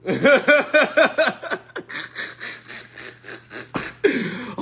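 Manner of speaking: long real laugh. Real, not acted. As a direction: bursts out laughing at the memory. Laughing at own story.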